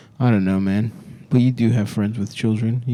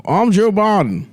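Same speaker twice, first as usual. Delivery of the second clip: slow, raised Joe Biden voice; Joe Biden impression; 7 semitones higher, slower than usual. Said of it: "This is a much better Joe Biden impression".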